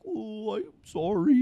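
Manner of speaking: high, slow, doofus-like sing-out; hammed-up goofy apologizer; mock apology. Mimicking the man standing there apologizing.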